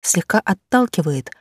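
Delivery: ordinary delivery, nothing marked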